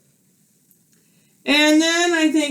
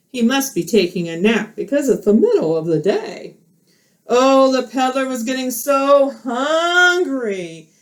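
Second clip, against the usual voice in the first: normal talking voice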